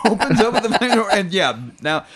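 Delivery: high, fast words through laughter; laughing while talking. Real, not acted. Host retelling the absurd flamethrower scene, laughing hard. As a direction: crack up as you tell it.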